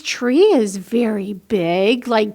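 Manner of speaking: slow, gentle storybook reading; unhurried read-aloud for kids; reading to children. A narrator's reading. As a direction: read it like bedtime to little ones. Reads a picture book aloud.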